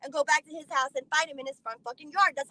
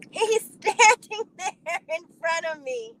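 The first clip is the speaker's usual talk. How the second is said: talking through real laughter, high and slow; helpless laughter; 6 semitones higher, 4 dB louder, slower than usual. Real, not acted. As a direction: squeeze the words out while cracking up. Cracks up at the punchline.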